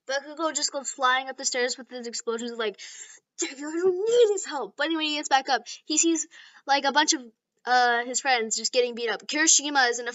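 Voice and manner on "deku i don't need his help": higher-pitched, put-out protest; indignant retort. Acted. Bakugo flying back upstairs, indignant. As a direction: protest as if insulted.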